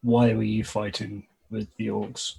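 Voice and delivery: ordinary talk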